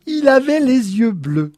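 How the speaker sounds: high, loud excited blurt; bursting out with the detail; excited confession. A narrator's reading. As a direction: blurt it out, pitch soaring. Excited wife blurts dream detail.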